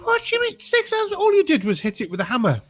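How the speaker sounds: very high, squeaky incredulous protest; indignant disbelief. Acted. Crew disputing the engineer's bill, indignant and incredulous. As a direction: voice shoots up in acted outrage, then eases down.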